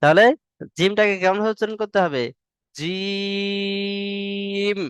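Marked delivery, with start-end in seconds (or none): 2.8-4.8 জিম stretched long from জি to ম; drawn jim. Shown as a demonstration. Teacher answers how জিম is pronounced. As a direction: hold the vowel of জিম steady, close on ম. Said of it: "জি থেকে টান শুরু হবে, ময়ে গিয়ে শেষ হবে".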